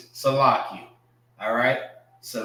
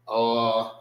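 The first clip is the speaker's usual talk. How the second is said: usual speaking voice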